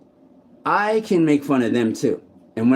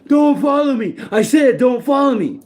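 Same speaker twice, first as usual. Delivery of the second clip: high, loud, shouted warning; furious retold yell from a bigger man; angry yelling neighbour; 13 semitones higher, 4 dB louder than usual. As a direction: bark it at him like a threatening stranger. Neighbour telling him off.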